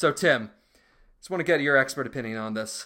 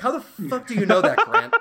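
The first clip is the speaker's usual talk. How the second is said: much higher, louder, laughing disbelief; incredulous outburst; 11 semitones higher, 8 dB louder than usual. Real, not acted. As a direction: squeal it out in disbelief.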